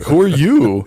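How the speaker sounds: indignant who are you. Retold or imitated. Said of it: "I was like".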